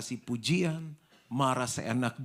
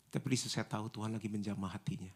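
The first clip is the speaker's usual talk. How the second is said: usual speaking voice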